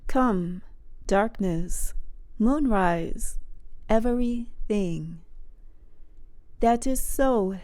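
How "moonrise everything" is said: usual speaking voice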